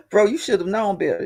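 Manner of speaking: usual speaking voice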